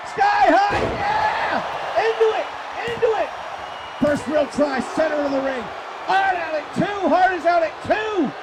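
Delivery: high, loud near-fall call; excited play-by-play. Real, not acted. Commentator calling a near fall at center ring, excited. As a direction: shout the count with rising urgency.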